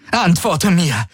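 losing her calm, demand an answer; angry demand. Acted. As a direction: snap at him to answer. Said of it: "Alle Ruhe war vergessen".

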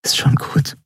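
hushed comforting whisper. A narrator's reading. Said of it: "flüsterte sie".

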